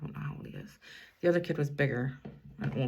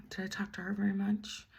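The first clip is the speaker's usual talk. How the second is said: whisper; 3 semitones higher, 7 dB quieter than usual. Real, not acted. Aside to self mid-story.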